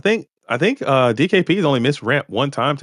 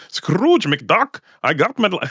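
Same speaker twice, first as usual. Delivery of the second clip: a much higher, strained cartoon-duck attempt; Scrooge McDuck voice; 8 semitones higher, slower than usual. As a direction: squeeze the voice up high to try out the rich duck. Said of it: "I'm trying to compete".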